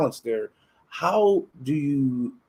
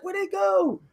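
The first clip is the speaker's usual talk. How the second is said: much higher, puzzled just-woke-up voice; confused surprise; slower than usual. Retold or imitated. Man waking alone asking where his friends went, puzzled. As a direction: sound lost and baffled.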